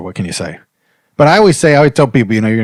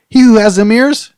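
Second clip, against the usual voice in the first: preaching; 14 semitones higher, 3 dB louder than usual. Acted. Preaching Bible advice to listeners.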